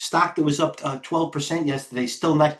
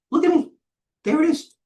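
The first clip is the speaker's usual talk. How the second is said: excited; 5 dB louder than usual. Real, not acted. Trade hits his price.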